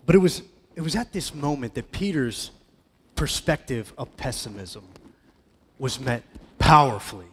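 ordinary delivery

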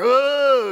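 loud, high mock grunt; exaggerated gym grunt; imitated lifting grunt. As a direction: imitate a weightlifter's drawn-out strained groan. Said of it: "they're grunting and they're".

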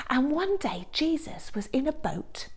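put-on storyteller's hush to draw children in; hushed story opening; whisper. A narrator's reading. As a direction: whisper it like a secret.

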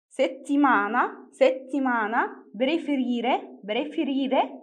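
slow, drawn-out Italian words with rising ends; word-list demonstration. Shown as a demonstration. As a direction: model each word slowly, syllable by syllable. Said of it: "نبدأ نقرأ الكلمات".

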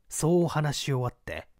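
plain reading voice

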